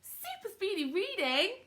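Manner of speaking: high, slow sing-song cheer; excited praise. Real, not acted. Teacher praising the class for speedy reading, excited cheer. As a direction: draw the praise out in a high lilt.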